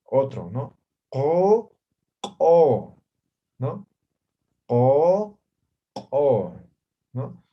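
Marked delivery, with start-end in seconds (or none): 1.1-3.0 ko with a sharp glottal burst on the k; explosive glottal ko. Shown as a demonstration. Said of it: "lo hagamos de forma explosiva". 4.7-6.6 ko with a glottal pop on the k; explosive glottal ko. Shown as a demonstration. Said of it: "lo hagamos de forma explosiva".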